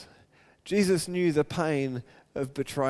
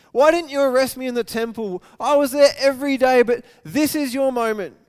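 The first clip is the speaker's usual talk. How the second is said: higher, louder, frustrated challenge; frustrated passion; 6 semitones higher, 7 dB louder than usual. Shown as a demonstration. Jesus to the arresting crowd, frustrated and loud. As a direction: confront them with passion. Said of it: "maybe if he was a little bit frustrated".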